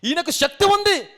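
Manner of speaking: high, loud burst of certainty; emphatic conviction. Acted. Doubter granting that God has power, loudly emphatic. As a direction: declare it with full force. Acting belief against following doubt.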